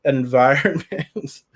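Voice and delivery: slow, playfully stretched word; playful drawl. Pretend. Tarot reader entertaining herself for viewers, playful slow drawl. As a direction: toy with the word, dragging it out with a laugh.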